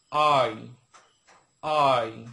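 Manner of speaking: "আই" loud and drawn out, long aa into short i; correct I pronunciation. Shown as a demonstration. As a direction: model "আই" slowly for learners, each take set apart. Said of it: "তাহলে আমরা আবার বলি". ("আই" জোরে, লম্বা আ আর ছোট ই দিয়ে)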